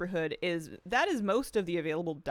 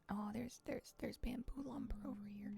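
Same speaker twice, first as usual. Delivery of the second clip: whispered to herself; whispering; 5 semitones higher, 13 dB quieter than usual. Real, not acted. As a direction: whisper it under your breath. Muttering to self while playing.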